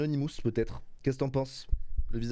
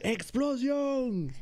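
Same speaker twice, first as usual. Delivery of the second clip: high, stretched-out mock shout; playful high holler; mock cry; 10 semitones higher, much slower than usual. Shown as a demonstration. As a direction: draw the word out as a playful high call. Models another shouted word for baby.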